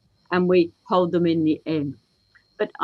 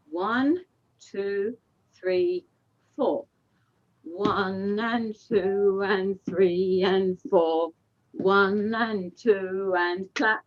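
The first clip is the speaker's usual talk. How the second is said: slow counted call; slower than usual. Shown as a demonstration. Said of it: "teacher calls the dance count".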